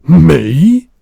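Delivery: huge booming shout; giant shouting. Acted. Giant shouting at the girl, booming. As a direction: roar it so the jars rattle. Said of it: "shouted the giant".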